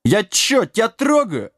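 high-pitched aggrieved protest; indignant. A narrator's reading. Giant protests he did nothing, indignant. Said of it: "возмутился Дибелмен".

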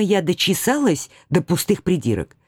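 ordinary delivery, nothing marked

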